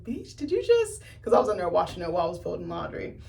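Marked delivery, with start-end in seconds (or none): 0.0-1.0 drawn out, pitched way up in shock; slow shocked disbelief. Retold or imitated. Pausing the movie mid-laundry, slow and very high in shock. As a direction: let the shock stretch the words.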